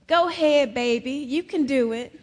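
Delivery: lightly voiced grandmama coaxing a child; a touch of church grandmother cheering a child on; encouraging old grandmama. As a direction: call out gentle encouragement, a hint of old grandmama. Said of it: "some old grandmama way out in the back".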